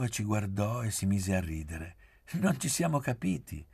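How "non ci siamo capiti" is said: high, teasing lilt; mocking. A narrator's reading. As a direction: tease them in a raised pitch. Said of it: "disse canzonatoria".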